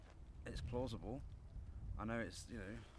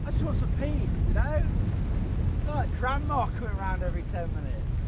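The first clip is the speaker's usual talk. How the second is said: loud, higher, fed-up snap; exasperated outburst; 8 semitones higher, 10 dB louder than usual. Real, not acted. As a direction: blow up at the intrusion. Annoyed at film crew arriving.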